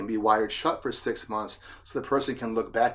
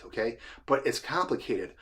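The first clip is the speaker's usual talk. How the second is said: normal talking voice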